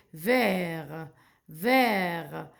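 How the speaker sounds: low, stretched "vert" ending on R; slow teacher's demonstration; silent T. Shown as a demonstration. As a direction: say "vert" as a long vair, no T. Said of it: "Le T, c'est une lettre muette".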